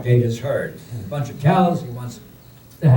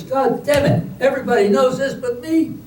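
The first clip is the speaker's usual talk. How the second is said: higher exasperated curse with a laugh; exasperated outburst; 12 semitones higher than usual. Acted. Man told the bread gets hard, exasperated curse. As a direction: blow up in comic frustration. Frustrated man in bakery punchline.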